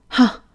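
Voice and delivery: loud scornful "ha"; a sharp, disbelieving scoff; scoffing laugh. Acted. Boss scoffs at her reply, loud scornful laugh.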